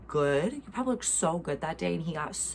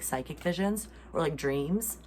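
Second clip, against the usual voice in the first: usual speaking voice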